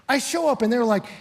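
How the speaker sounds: ordinary talk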